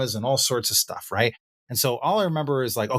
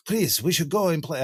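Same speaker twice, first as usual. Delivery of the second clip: higher-pitched mock French accent; 6 semitones higher than usual. Voicing a salesperson inviting a dive, in a French accent. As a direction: put on a playful French guy voice. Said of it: "sounds like French guy".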